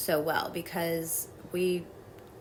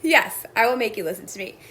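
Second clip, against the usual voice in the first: louder, put-on defiant kid; mock childish defiance; bratty child voice; 3 semitones higher, 8 dB louder than usual. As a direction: stamp your foot like a stubborn kid. Inner child going.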